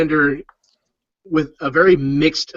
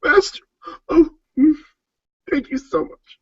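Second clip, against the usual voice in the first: high, breathless gushing gratitude; overwhelmed thanks; 13 semitones higher than usual. Acted. The seamsman gushes high-pitched thanks to Virion. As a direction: gush your thanks way up high.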